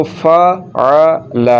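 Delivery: फ़अल very slow, each letter apart. Shown as a demonstration. Teacher easing the reading for pupils, slow separated letters. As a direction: stretch फ़अल as fa... a... la. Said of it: "अकेले हरफ को अगर आप पढ़ेंगे".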